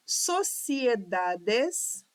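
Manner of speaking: "sociedades" read slowly in detached pieces; syllable by syllable. Shown as a demonstration. Teacher checking syllable division, "sociedades" syllable by syllable. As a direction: sound out "sociedades" slowly, every vowel full. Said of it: "divisão silábica de todas as palavras".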